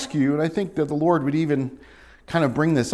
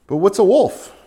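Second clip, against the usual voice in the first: ordinary delivery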